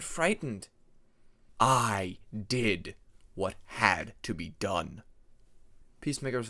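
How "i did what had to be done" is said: low, slow and cold; cold justification. A narrator's reading. Peacemaker's dark voice defending the killing, low and slow. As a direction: state it flatly, unrepentant.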